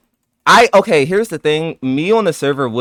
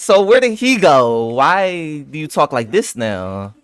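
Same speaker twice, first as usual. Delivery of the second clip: slow, drawn-out challenge starting high; mock interrogation; 3 semitones higher, slower than usual. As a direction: draw each question out accusingly. Mock interrogation of absent friend.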